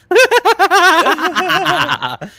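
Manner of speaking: high mock maniac cackle; Joker laugh. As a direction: imitate an unhinged Joker laugh. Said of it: "we got the Joker here".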